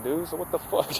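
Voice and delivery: ordinary delivery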